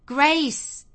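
sharp, high, loud exclamation; exclaiming. A narrator's reading. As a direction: call a servant's name out loudly. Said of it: "exclaimed Missus Fairfax".

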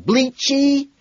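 high, stunned repeat; a squeaky, shocked single word; disbelieving. A narrator's reading. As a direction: echo the word in sheer disbelief.

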